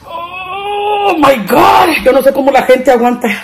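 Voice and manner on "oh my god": long drawn-out high wail; crying out in pain. Real, not acted. Vlogger burning from chili cries out to viewers, wailing. Burning mouth in spicy challenge.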